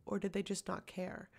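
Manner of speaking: ordinary delivery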